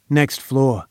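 plain reading voice

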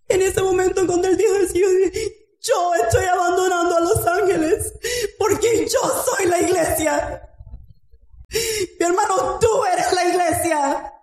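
high, fervent preaching cry; preaching at full force. Acted. Preacher rousing the congregation, fervent high cry. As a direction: lift it up and preach it to the church.

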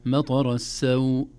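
loud chanted مطر السوء, briefly lengthened ending; short madd. Shown as a demonstration. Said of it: "كذلك السوء".

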